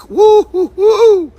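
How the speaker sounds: high, loud whooping woo woo woo; excited woo calls. Real, not acted. Streamer hyped by his own joke, loud high woo calls. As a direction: whoop it up like a hype man. Hype shout after deep chocolate.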